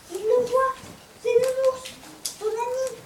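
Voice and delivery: high, loud, thrilled narration; joyful discovery. A narrator's reading. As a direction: burst out with the happy news. Joyful discovery on Christmas morning.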